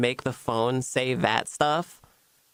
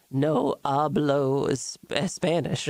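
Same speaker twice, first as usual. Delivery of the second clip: slow halting broken-Spanish voice; Doris voice; slower than usual. Acted. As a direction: drag it out as a lost foreigner. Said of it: "Doris voice".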